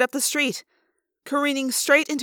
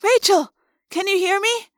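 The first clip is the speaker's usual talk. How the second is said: put-on high-pitched girl's call; urgent calling; 7 semitones higher than usual. A narrator's reading. Girl trying to reach dazed friend, high urgent call.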